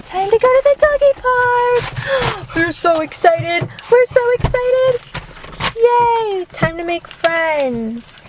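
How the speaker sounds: high, loud squeal to the pets; excited dog talk. Pretend. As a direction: hype up the dogs in a high, drawn-out voice. Hyping up dogs for park.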